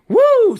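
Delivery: high, loud "woo"; fired-up whoop. Real, not acted. Fired-up speaker venting energy to viewers, excited whoop. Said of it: "I'm fired up about this".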